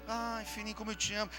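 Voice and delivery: mock tender parent. Parent cooing to child in bed.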